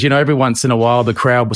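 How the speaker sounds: normal talking voice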